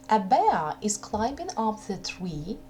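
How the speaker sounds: usual speaking voice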